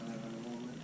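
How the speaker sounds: ordinary talk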